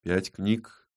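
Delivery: subdued, earnest reply; serious. A narrator's reading. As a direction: answer soberly, without pushing. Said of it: "серьёзно ответил".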